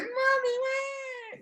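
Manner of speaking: mock child wail, extremely high; squealed, drawn-out fake crying; kids' breakdown. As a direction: imitate little kids bawling for their mother. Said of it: "they have a little breakdown".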